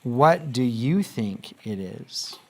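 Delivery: very slow, rising at the end; slow, clear teacher reading; slow read-aloud. Shown as a demonstration. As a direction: read it slowly for the class to hear. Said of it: "I will read the sentence to you one more time".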